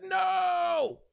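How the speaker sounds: high, drawn-out cry of no; a dramatic wail of no as his defenses fall; mock anguished no. Ukrainian girl says he is safe, he cries out. Reacting to her words.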